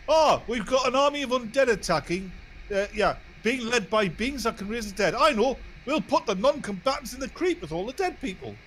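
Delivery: high, mocking, clueless voice; mocking dumb planner. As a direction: play the showrunners as total idiots. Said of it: "So what do we do?".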